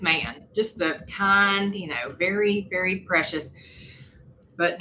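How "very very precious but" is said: ordinary talk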